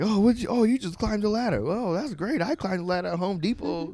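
high-pitched mock praise; mocking taunt. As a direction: needle a rival with exaggerated amazement. Mock quote of wrestler's sneering taunt.

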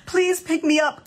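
high-pitched needy begging; clingy, needy plea. Acted. Needy kid begging a parent to be picked up, high clingy plea. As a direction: plead like a clingy, codependent child. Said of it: "clingy and needy and codependent".

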